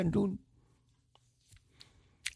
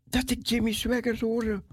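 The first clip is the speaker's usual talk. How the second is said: ordinary talk, nothing marked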